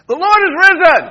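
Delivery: loud, high preacher's call; call to the congregation. Real, not acted. Pastor calls out to the church on Easter, loud and high. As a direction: shout it out to rouse the room. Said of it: "you do it with me".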